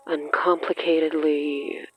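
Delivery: ordinary talk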